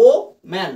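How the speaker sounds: ও ম্যান in two beats with a short pause after a strong ও; split in syllables. Shown as a demonstration. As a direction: punch ও, stop, then say ম্যান. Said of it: "দুবারে কিন্তু ওয়ার্ডটা উচ্চারিত হচ্ছে". (ও ম্যান দুই ভাগে ভেঙে বলা)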